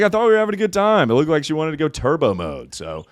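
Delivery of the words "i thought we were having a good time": fast, high and loud protest; defensive excitement. Retold or imitated. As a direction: blurt it out innocently, fast. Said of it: "I was like".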